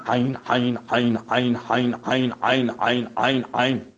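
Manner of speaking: عین repeated from the throat, Arabic style; repeated ain drill. Shown as a demonstration. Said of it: "ده دقیقه میگید عین".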